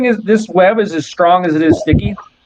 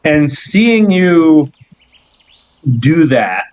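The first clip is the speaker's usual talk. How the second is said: ordinary talk, nothing marked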